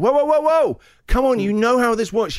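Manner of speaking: a brisk coach halting him mid-lift; gym trainer. Retold or imitated.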